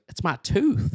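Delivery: Turkey Feather. Acted. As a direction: announce it in a put-on cartoon kid voice. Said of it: "looking and sounding like Bobby Hill".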